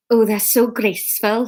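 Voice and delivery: ordinary delivery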